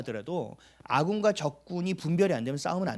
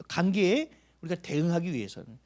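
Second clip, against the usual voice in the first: ordinary talk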